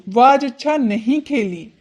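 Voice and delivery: ordinary talk, nothing marked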